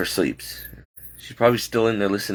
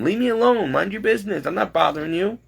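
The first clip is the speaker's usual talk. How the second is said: fed-up outburst; 9 semitones higher than usual. Real, not acted. Ranting at people who meddle.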